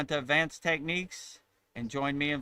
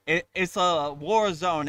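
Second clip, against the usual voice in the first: usual speaking voice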